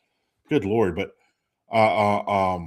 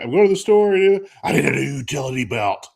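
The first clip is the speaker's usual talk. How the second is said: mocking wife; 5 semitones higher, 5 dB louder than usual. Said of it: "she's like".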